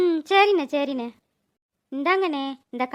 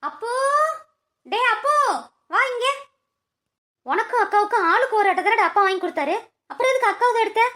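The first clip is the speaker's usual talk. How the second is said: put-on raised, higher call to the boy; calling out sharply; 4 semitones higher, 4 dB louder than usual. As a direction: call him over, then scold. Mother calls, scolds son.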